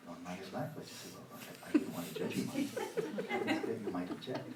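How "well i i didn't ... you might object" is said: laughing mock apology. Mock apology to own mind.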